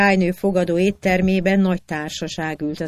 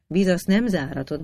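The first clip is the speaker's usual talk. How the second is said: ordinary delivery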